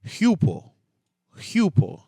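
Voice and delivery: HUPO with a clear H, high and emphatic; H at the start. Shown as a demonstration. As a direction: breathe an H onto the front of HUPO. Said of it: "pronounce it with an H".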